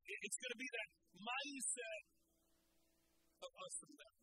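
loud, driving sermon delivery; full-force preaching. Acted. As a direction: preach it hard to the crowd. Preaching to congregation.

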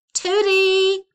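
loud, high yell from the back door; calling his wife from the back door, loud and high; shouted. A narrator's reading. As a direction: bellow the name across the yard. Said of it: "shouted Mister Tickum".

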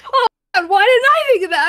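excited shriek. Retold or imitated.

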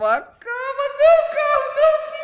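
high, loud panicked cry at the car; yelling at the car. Real, not acted. Gamer yelling at his flipping car, panicked and loud. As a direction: shriek at the tumbling car.

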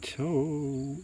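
slow, word-by-word spooky groan; long suspenseful gap after each word, last word rising; hushed, eerie creature closing in; groaning ghostly voice. A narrator's reading. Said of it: "the voice groaned".

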